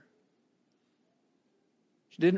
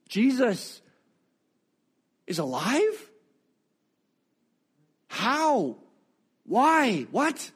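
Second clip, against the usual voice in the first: higher, rising bursts of disbelief; Mary reeling at the risen Jesus, higher and incredulous; stunned disbelief; 6 semitones higher, much slower than usual. Acted. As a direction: voice her stunned questions. Imagining Mary's stunned thoughts.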